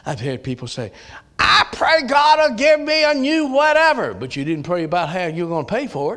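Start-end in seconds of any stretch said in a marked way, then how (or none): 1.4-4.2 high, laughing mock prayer voice; a silly church member asking God for goodies; mocking a greedy prayer. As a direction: send up a spoiled prayer with a laugh. Mocking what people pray for.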